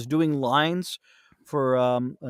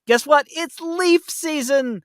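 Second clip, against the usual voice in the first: mock announcement; 13 semitones higher, 4 dB louder, slower than usual.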